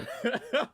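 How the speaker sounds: squealing laugh at the clip; high burst of laughter. Real, not acted. Streamer losing it over a viral clip, loud high laugh. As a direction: crack up helplessly, high and loud.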